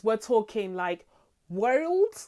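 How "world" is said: "world" in a mock Oz Cobb accent, high and rising; playful stab at the Oz Cobb accent; Oz Cobb accent attempt. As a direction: try Oz Cobb's odd accent on "world", amused. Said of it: "that accent is impossible".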